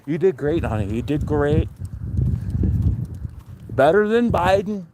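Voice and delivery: a hint of a Trump voice, rising high on the last line; mild Trump impersonation praising his daughter. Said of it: "I looked at her, I said".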